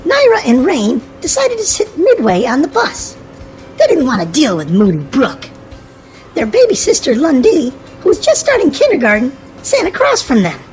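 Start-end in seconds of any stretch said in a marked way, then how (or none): none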